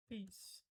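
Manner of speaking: "Peace" as one slow syllable, long ee; correct English pronunciation. Shown as a demonstration. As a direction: model "Peace" softly with a long ee. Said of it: "mas seria peace". ("Peace" numa sílaba, com i longo)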